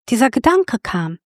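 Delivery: ordinary delivery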